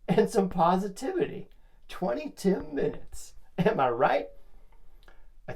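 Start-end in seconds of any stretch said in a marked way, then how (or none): 1.9-3.3 much higher and slow, sing-song; announcer sign-off lilt; drawn-out show name. Acted. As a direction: stretch the show name up high like a jingle. Show name, sung out as a tag.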